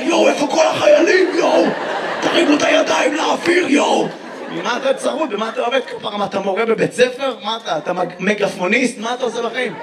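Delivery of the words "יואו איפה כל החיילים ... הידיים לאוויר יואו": a hammed-up rapper yelling to the crowd; mock rapper hype. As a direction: pump up the crowd like a rapper on stage. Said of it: "מה, אתה ראפר?".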